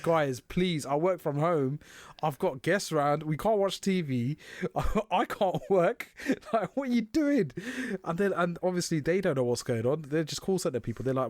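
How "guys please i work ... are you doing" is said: pleading. Retold or imitated. Pleading with Virgin support.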